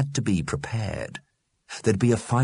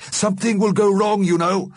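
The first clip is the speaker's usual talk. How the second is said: warning; 9 semitones higher, 4 dB louder than usual. A narrator's reading. Mister Grimm warns the departing dead, foreboding. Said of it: "warned Mister Grimm".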